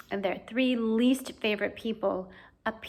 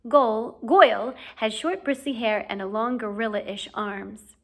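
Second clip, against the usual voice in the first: ordinary delivery, nothing marked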